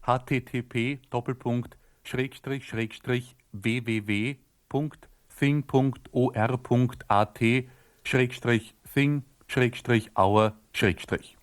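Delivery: ordinary delivery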